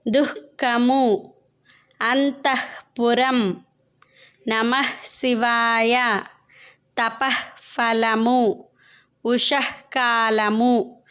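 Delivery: slow syllables with an "h" break after the visarga in "దుఃఖము"; visarga demonstration. Shown as a demonstration. Teacher showing pupils visarga words, slow split-syllable demonstration. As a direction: split "నమః శివాయ" slowly at the "ah", stretching what follows. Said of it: "విసర్గ పదాలు అని అంటారు".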